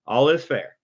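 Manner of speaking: ordinary talk, nothing marked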